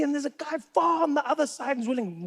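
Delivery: ordinary delivery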